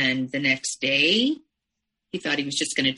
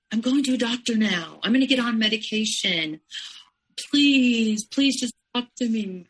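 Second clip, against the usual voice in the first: higher, pleading imitation of a crying man; imitating crying pleas; 5 semitones higher, 3 dB louder than usual. Ex at her door, retold begging through mock tears. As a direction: mimic him begging, a touch tearful. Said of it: "he ended up at my doorstep crying".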